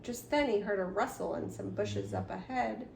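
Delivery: ordinary delivery, nothing marked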